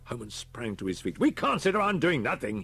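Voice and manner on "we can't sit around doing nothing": louder, higher outburst; shouted. Acted. Holman shouts at the inspector to act, a raised outburst. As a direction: burst out, frustrated at the waiting. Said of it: "he shouted".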